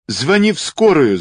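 urgent high-pitched cry; shouted. A narrator's reading. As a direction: call out in alarm for help. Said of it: "закричала Вензель". (крик о помощи)

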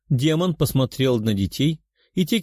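usual speaking voice

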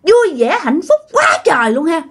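high, bitter spiteful voice; jealous ghost. Retold or imitated. Ghost of the father envying the happy couple, spiteful and high. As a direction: spit out the envy. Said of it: "Nói kiểu vậy các bạn, ganh tị".

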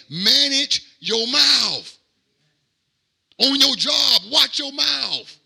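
loud, rising sermon delivery that climbs toward a cry; full-force preaching. Acted. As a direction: preach it to the whole church, building. Preacher building again.

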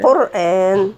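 teacher stressing the vowel; stressed madd. Shown as a demonstration. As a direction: hit the aa in قرآن. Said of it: "مد بالألف".

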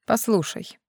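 understated, softly serious request to listen; serious, focused. A narrator's reading. Man asking the journalist to listen, serious and focused. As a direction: say it soberly, dropping the volume. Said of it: "Всё в том же серьёзном, сосредоточенном тоне".

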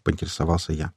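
usual speaking voice

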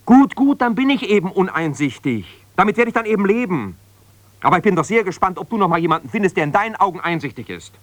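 laut und hoch, gekränkt und trotzig; indignant outburst. Acted. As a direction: schlag gekränkt und höhnisch zurück. Ehestreit am Telefon, gekränkter Ehemann. (empört, deutlich höher und lauter)

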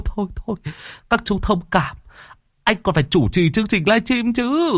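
high, begging comic man; high whiny pleading. Acted. Thot pleading in story.

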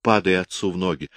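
ordinary delivery, nothing marked

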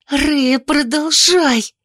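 high, tense order with drawn-out ending; hissed command. A narrator's reading. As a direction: snap the order back, stretching the last word. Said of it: "зашипела Оливия в ответ".

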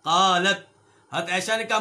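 ordinary talk, nothing marked

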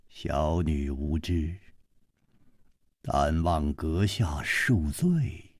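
low, slow, soft formal voice; grave elder. A narrator's reading. Old swordsman asking the guest's pardon for his daughter, grave. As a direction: apologise quietly and slowly, with deep gravity.

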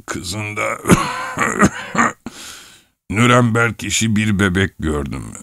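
put-on slow old man racked by coughs; acted elderly rambling, broken by coughing; coughing old husband. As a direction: drag the words out between coughing fits.

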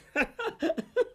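lacht hoch, glücklich los; hohes Triumphlachen. Acted. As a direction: Lachen der Erlösung. Jubelndes Lachen. (hohes, glückliches Lachen)

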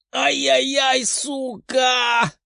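high, drawn yelp of pain; high-pitched howling curse; pained shout. A narrator's reading. Boy kicks massive dog, hurts foot.

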